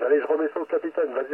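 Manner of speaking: ordinary talk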